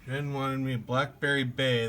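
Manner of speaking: normal talking voice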